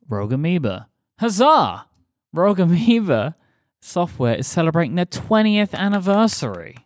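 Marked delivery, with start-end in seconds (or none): 1.2-1.9 booming mock herald cheer; grand rich voice. 2.3-6.8 lightly hammed herald announcement; grand rich voice.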